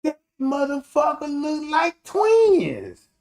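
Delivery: high, loud and slow in disbelief; real amazement, drawn out; amazed drawl. Real, not acted. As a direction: stretch it out, floored by the likeness. Marvelling at lookalike.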